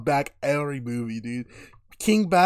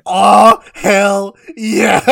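loud, high imitation of a triumphant cheer; big hammed-up whoop; hyped character quote; 7 semitones higher, 13 dB louder, much slower than usual. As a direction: belt it out like a returning muscle man. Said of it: "He goes".

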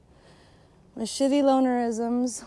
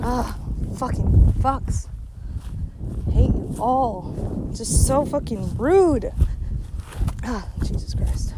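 angry grumble; 3 dB louder, slower than usual. Real, not acted. Venting anger at rude people.

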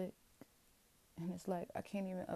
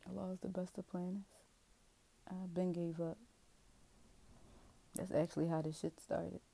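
ordinary talk, nothing marked

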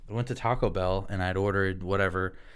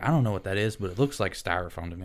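ordinary talk, nothing marked

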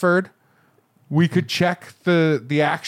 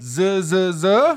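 higher, lightly hammed-up questioning; barber double-checking; 6 semitones higher than usual. Barber confirming with a customer in the chair, mock double-checking. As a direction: act out an incredulous check, stretching the question. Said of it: "You have to go".

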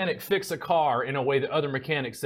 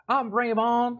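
higher-pitched mock Cajun drawl; Cajun accent; 9 semitones higher, slower than usual. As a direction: put on a cartoon Louisiana Cajun accent. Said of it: "expect me to talk like".

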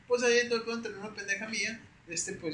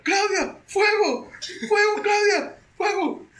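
high, loud panicked calling; alarmed yell; 12 semitones higher, 10 dB louder, much slower than usual. Retold or imitated. Roommate bursting in, yelling fire at Claudia. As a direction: call out for help like it's burning.

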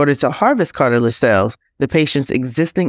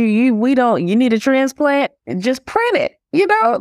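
high, breathless TV-drama doctor; quoting Grey's Anatomy; 10 semitones higher than usual. Retold or imitated. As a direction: gush it like a medical show. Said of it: "are you quoting it?".

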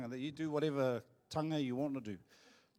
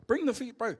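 calling out; 9 semitones higher, 7 dB louder than usual. Retold or imitated. Urging cousin to bring food.

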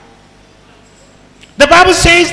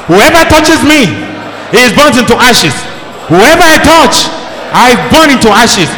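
preaching prayer. Acted. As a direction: proclaim each line with fire.

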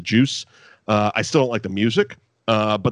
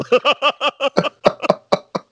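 loud high laugh; 14 semitones higher, 4 dB louder than usual. Real, not acted. Laughing at the story.

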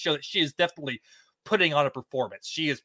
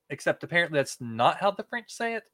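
ordinary delivery

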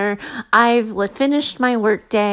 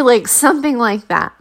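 ordinary delivery

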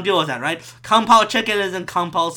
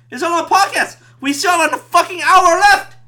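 usual speaking voice

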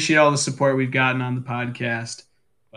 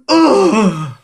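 Bespin guard grunt; 6 semitones higher, 5 dB louder than usual. Retold or imitated. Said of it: "They just go".

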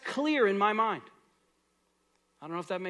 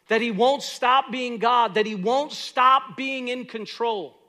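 full-force preaching; 4 semitones higher, 8 dB louder than usual. Acted. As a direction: preach it out to the congregation. Preaching climax on Jesus king.

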